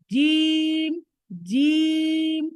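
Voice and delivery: জিম said hard and loud, long held; a strong, stretched জিম; hard jeem. Shown as a demonstration. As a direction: press জিম firmly from the throat. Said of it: "আমরা এরকম বলবো".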